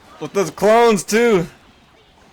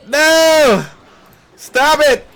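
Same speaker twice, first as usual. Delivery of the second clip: high, loud yelling at the screen; panicked shout; 11 semitones higher, 6 dB louder, much slower than usual. Real, not acted. Player shouting at the opponent's princesses, panicked shout. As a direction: cry out to stop the attack. Enemy princesses overrun his tower.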